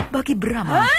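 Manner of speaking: ordinary delivery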